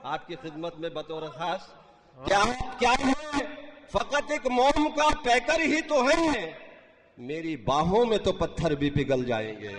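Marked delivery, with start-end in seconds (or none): 2.3-9.7 declaimed Urdu verse; stately nazm recitation. Acted. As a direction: recite the couplet with grand feeling. Mushaira poetry recitation. (شاہانہ انداز میں نظم خوانی)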